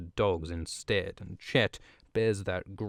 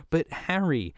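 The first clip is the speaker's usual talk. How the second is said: higher, breathless know-it-all; eager Hermione voice; 5 semitones higher than usual. Retold or imitated. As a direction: play a keen schoolgirl setting him straight. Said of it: "and she says".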